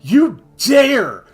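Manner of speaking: booming megalomaniac god voice; dark lord. Pretend. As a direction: roar it like a mad tyrant god. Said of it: "megalomania ran away with me".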